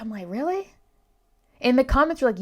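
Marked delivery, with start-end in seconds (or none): 0.3-0.7 incredulous. Retold or imitated. Disbelief at friendly Americans claim.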